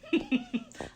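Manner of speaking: bright burst of laughter; a quick delighted laugh; joyful laugh. Real, not acted. Joyful laugh mid-message.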